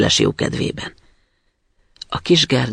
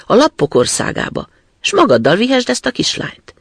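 put-on brash, loud robber girl; a wild girl being generous her own way; 4 semitones higher, 4 dB louder than usual. Robber girl frees the reindeer. (harsány rablólány)

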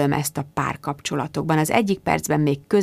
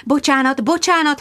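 gabbling; 8 semitones higher than usual. A narrator's reading. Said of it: "hadarta Sharon".

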